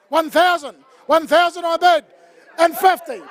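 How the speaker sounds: rapid auction chant, calling bids; auctioneer calling. Acted. Runs the charity auction.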